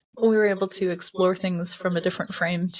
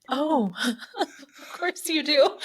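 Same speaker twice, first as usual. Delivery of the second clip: higher, slow, breaking into laughter; amused mock reply; 7 semitones higher, much slower than usual. Hypnotist reacting to client's odd comment, laughing. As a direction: laugh through the drawn-out words.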